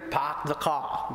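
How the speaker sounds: slow Boston-style dropped R; Boston accent. Shown as a demonstration. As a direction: drop the Rs like a Bostonian, drawn out. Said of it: "nawawala yun daw yung kanilang R".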